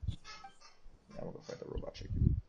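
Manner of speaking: usual speaking voice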